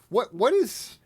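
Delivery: high-pitched, incredulous questioning; stumbling, exasperated disbelief; baffled exasperation. Real, not acted. Baffled rant about the sentences.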